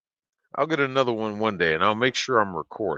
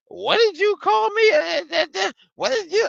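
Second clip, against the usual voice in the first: very high, mocking squawk; hammed-up shrieky indignation; mimicked outraged customer; 3 dB louder than usual. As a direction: imitate an affronted customer in falsetto.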